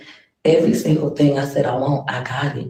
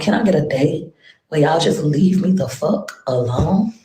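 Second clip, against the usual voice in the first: ordinary delivery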